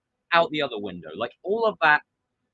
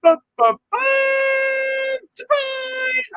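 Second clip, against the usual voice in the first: high shouted ta-da surprise; hammed-up surprise shout; mock fanfare shout; 7 dB louder, slower than usual. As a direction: shout a big sarcastic reveal.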